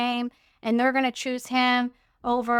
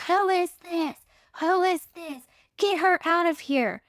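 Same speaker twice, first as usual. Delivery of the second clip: retold scream; 6 semitones higher than usual. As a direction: imitate a screamer in a higher voice, volume held back. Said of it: "And he was screaming".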